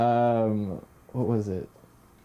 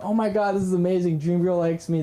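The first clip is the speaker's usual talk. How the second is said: giddy retold gushing, pitched up; higher, excited inner voice; elated reaction; 5 semitones higher than usual. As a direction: gush like the dream date is happening. Retelling elation at dream girl.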